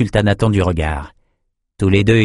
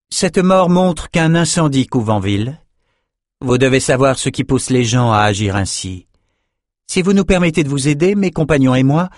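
higher, earnest appeal; plaidoyer pressant; 6 semitones higher than usual. A narrator's reading. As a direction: urge them to accept help, pitched up. Nietzsche intervient, plaide pour aider. (appel insistant, voix plus haute)

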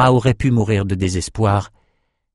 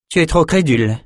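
curt dismissive retort; 7 semitones higher than usual. Acted. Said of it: "lâcha Nietzsche".

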